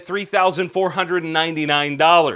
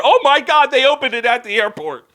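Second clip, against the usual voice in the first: loud, high outburst of disbelief; mock shock; 7 semitones higher, 4 dB louder than usual. Host reacts to a gun shop opening at an airport, mock shock. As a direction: blurt it out, hammed-up astonishment.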